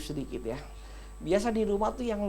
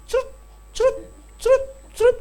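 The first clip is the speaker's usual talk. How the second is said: high squirting sound; water-pistol squirt; 8 dB louder, faster than usual. Retold or imitated. Pastor imitating a water pistol for gentle wives, high squirts. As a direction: squeak it like a little water gun. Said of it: "kalau keluar lembut".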